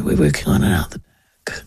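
low bored staffer; low mumbling barista. Retold or imitated. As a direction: play the barista low and uninterested. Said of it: "quoted barista".

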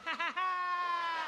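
put-on high, long drawn-out child's cackle; hammed-up French kid laugh; kid's mocking laugh. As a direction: imitate a boy laughing in derision. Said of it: "this kid's like".

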